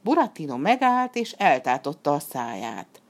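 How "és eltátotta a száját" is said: plain reading voice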